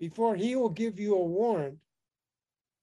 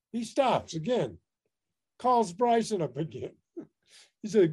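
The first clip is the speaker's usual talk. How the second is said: ordinary delivery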